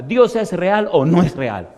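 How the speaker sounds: lenta, alta y fuerte; pregunta desafiante. Real, not acted. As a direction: reta a la congregación. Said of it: "predicador desafía". (predicador desafía a la iglesia, lento y fuerte)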